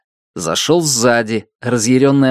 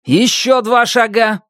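commanding; 10 semitones higher than usual. Acted. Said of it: "распорядился Руслан".

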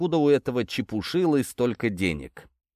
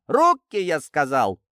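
much higher and louder on Руки, then drops; escalated furious yell; 6 semitones higher, 4 dB louder, slower than usual. Acted. Sergeant repeating the order to the detainee, furious yell. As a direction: blow up when he won't obey. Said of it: "зло скомандовал сержант".

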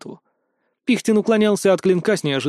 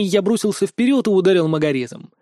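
usual speaking voice

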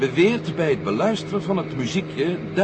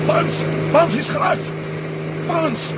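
alarmed shout; 11 semitones higher, slower than usual. Acted. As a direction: call out to the wounded man. Crewman hit, calling out.

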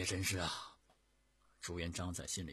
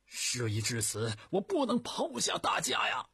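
impassioned declaration; 10 semitones higher, 10 dB louder than usual. A narrator's reading. Zhu Yuanzhang refusing to abandon his men, impassioned loud cry. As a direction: proclaim it with fervour, voice climbing to a peak. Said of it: "慷慨激昂地说".

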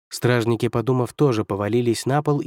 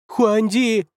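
very high-pitched squealing cry; shriek; 11 semitones higher, 3 dB louder than usual. A narrator's reading. First counselor appealing to the emperor, shrieking. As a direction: squeal the name in protest. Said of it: "визжал первый советник".

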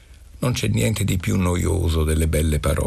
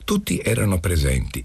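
ordinary delivery

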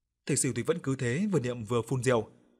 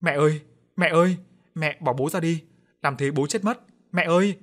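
put-on higher, loud panicked wail of a little boy; loud crying child; 5 semitones higher, 6 dB louder than usual. A narrator's reading. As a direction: cry out for Mum, pleading. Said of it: "thằng con thấy thế thì khóc ầm ĩ lên". (khóc lóc kêu mẹ ầm ĩ)

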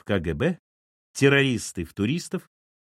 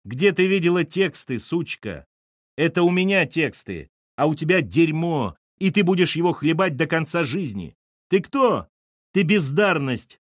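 vicious tirade; 5 semitones higher than usual. Acted. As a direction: spit insults at her. Lyosha insults Allochka. (злобная тирада)

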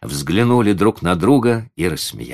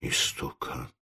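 hushed, low grumble; gloomy grumble; 4 semitones lower, 12 dB quieter, slower than usual. A narrator's reading. Old man repeating the lady's insult, grumbling. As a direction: grumble the insult under your breath. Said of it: "угрюмо проворчал Ладышкин".

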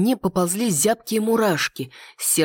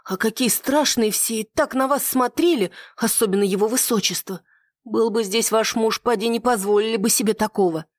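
higher-pitched maid, mildly alarmed; maid's alarm; 5 semitones higher than usual. A narrator's reading. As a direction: fret to your mistress in a raised voice. Frightened maid gossips to her mistress.

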